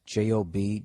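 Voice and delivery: usual speaking voice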